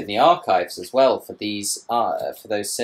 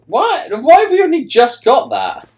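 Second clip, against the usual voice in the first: surprised; 12 dB louder than usual. Real, not acted. Sudden discovery in the game.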